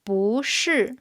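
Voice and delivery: "bu" rising before falling "shi"; second-tone bu. Shown as a demonstration. Teacher showing bu before a fourth tone, "bu" rising before "shi". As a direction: lift "bu" upward, then drop "shi". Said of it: "it becomes a second tone when followed by".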